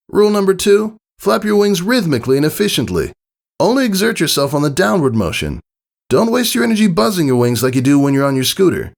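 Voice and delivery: Rainbow Dash voice. A narrator's reading. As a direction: instruct the nervous filly like a sporty coach. Flight lesson in character.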